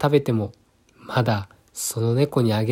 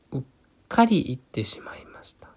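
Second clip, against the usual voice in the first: ordinary delivery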